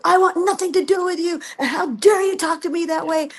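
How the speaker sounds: high, indignant telling-off; retold yell. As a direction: reenact telling the producer off, pitch up. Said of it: "And then I yelled at him".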